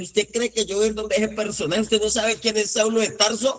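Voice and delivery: high, indignant mock scolding; a disciple lightly voiced scolding his Lord; scolding Ananías. Ananías scolds the Lord, high and indignant. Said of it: "Ananías regañó al Señor".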